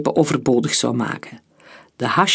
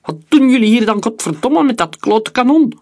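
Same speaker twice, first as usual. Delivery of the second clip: higher, louder swearing yell; furious shout; 7 semitones higher, 5 dB louder than usual. A narrator's reading. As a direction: scream at the workers. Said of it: "schreeuwde de opzichter".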